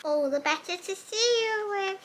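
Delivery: a lightly voiced cunning wolf; wolf as granny.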